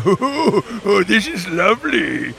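a hint of a gleeful old voice actor, laughing; mock-savoring the win with a chuckle; Mike Reynolds impression. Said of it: "he's going".

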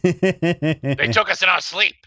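lightly voiced Rick, slowed and drawn out; a hint of old mad scientist Rick; Rick impression. Co-host quoting Rick to his friend, slow mock voice. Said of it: "in that Rick voice".

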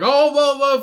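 high, loud greeting hollered across the room; mock yell across bar. Drunk bar regulars greeting friends, hollering across the bar. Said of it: "fucking yelling all the way across the bar".

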